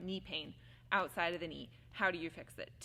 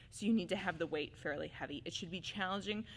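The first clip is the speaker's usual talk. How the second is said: ordinary talk, nothing marked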